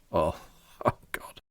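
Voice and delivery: breathless whispered laugh of disbelief; a soft whisper with a stunned little laugh; real shocked whisper and laugh. Real, not acted. Shocked at the headline.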